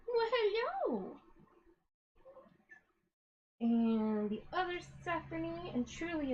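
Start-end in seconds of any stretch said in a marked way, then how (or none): 0.1-1.2 much higher, slow drawn-out hello; much higher, slow cooing greeting to her mom; sing-song hello. Pretend.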